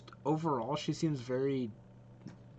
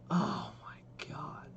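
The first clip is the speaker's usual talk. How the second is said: slow, half-whispered awe; swooning half-whisper; awestruck; 3 semitones higher, much slower than usual. Real, not acted. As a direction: draw it out on a breath, stunned. Awed by character demo.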